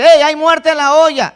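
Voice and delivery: a brave member shouting the warning to the church, alarmed. Retold or imitated. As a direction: yell the warning to the whole church. Someone stands up and cries out.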